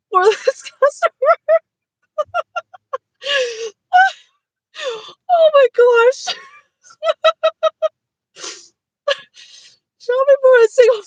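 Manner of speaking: giggling through the words; breaking up with real laughter; laughing. Real, not acted. Giggling through shopping errand.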